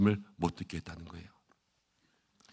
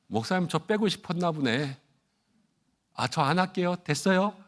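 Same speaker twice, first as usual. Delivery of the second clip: miffed; 7 semitones higher than usual. Acted. Acts out a miffed church member.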